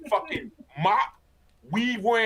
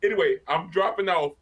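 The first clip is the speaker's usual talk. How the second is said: ordinary talk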